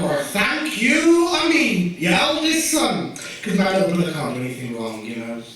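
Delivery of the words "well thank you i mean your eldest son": high, slow, sarcastic thanks; sarcastic retort. Retold or imitated. Son correcting his mother that he is the eldest, slow sarcasm. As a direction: drag it out with mock offence. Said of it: "I'm like".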